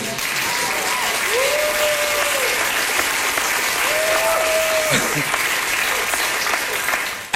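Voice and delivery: long high falling laugh. Real, not acted. Laugh after mother's quote.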